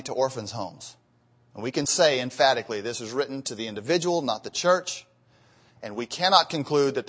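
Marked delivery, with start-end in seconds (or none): none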